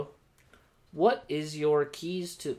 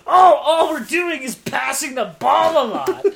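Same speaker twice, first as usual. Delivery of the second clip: high, loud, slow complaint; mock sideline commentator; 11 semitones higher, 10 dB louder than usual. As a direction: parody a griping fan at full volume. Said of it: "all the things I've heard from people commentating".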